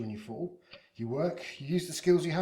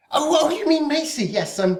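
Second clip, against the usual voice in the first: flustered; 11 semitones higher, 13 dB louder than usual. Acted. Caught out about his companion.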